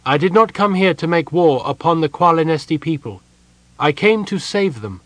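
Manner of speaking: earnest defence before accusers; serious, earnest. A narrator's reading. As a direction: declare it with total conviction. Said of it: "serious and earnest".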